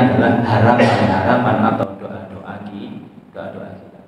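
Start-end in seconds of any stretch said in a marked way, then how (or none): 2.0-4.0 hushed. Real, not acted. Trailing off into a hush.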